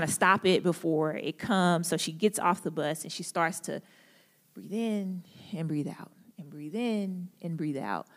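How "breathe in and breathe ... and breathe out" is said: whispered, calming breath cue. Retold or imitated. Young lady calming her breathing.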